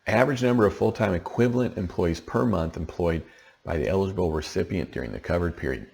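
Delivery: normal talking voice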